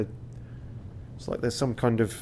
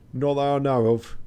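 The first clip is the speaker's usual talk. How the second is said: raised voice with mock French accent; put-on French accent, a bit higher; passable French accent; 4 semitones higher, 5 dB louder than usual. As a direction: play a French-accented crewman. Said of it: "This is my passable French accent".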